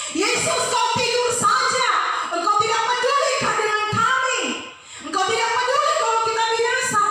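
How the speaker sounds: high, loud, reproachful cry at Yesus; mock the disciples scolding a sleeping Yesus; hammed-up angry disciples. Disciples in the storm blaming Yesus, high, loud reproach. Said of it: "mereka datang marah sama Yesus".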